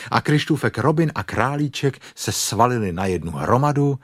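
ordinary delivery

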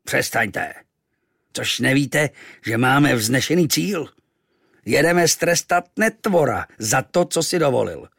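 Captain Achab roaring at noisy sailors. Acted. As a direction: bellow orders at the crew. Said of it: "zařval".

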